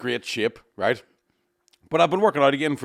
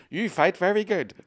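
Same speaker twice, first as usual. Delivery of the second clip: Stephen Hawking impression; 8 semitones higher, slower than usual. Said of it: "Do your impression of Stephen Hawking".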